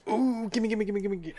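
high, eager begging; greedy glee. Acted. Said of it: "He's like".

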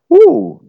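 high loud ooh of mock alarm; a whooping ooh, as if someone got burned; ooh reaction. Real, not acted. Preacher reacting to Paul's curse, high loud ooh.

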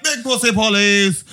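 loud, high burst of meme line; excited meme quote. Retold or imitated. Friends laughing at a meme, shouting its line. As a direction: blurt the meme title with glee.

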